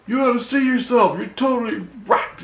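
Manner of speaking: high-pitched retold reproach; mock scolding. Retelling Christ telling the speaker off, high scolding.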